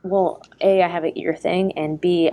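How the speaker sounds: normal talking voice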